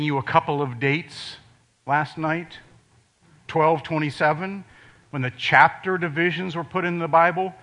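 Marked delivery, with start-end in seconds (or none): none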